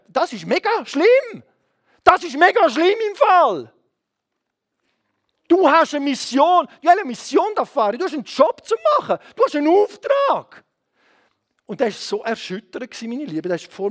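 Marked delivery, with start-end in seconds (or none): 0.1-3.7 high, loud retort with drawn-out words; emphatic rebuke. Retold or imitated. Preacher answering the businessman, loudly rebuking. 5.5-10.6 high, loud shouted charge; urgent exhortation. Retold or imitated. Preacher charging the businessman with his mission, shouting.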